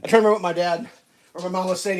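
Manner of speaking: ordinary talk, nothing marked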